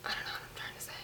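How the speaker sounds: breathy unvoiced whisper to herself; whispered aside, trailing off. Real, not acted. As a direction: drop to a whisper, half to yourself. Aside muttered under breath.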